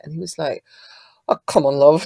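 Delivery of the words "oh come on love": imitating a Leeds accent, a little louder; lightly put-on Leeds accent. Songwriter mimicking her co-writer's advice, Leeds accent. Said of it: "with his lead accent".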